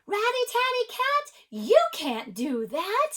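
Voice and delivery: high, loud scolding shout at a cat; storybook shout. A narrator's reading. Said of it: "The people shouted".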